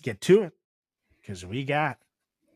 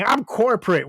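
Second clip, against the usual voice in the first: raised, indignant claim; mock scream; 6 semitones higher, 3 dB louder than usual. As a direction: scream it like a caught employee. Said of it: "the guy at Subway screaming".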